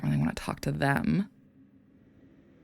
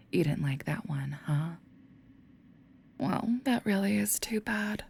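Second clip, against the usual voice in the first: mock pity whisper; 4 semitones higher than usual. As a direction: pretend to feel sorry, then twist the knife. Demon mockingly taunts Rookie.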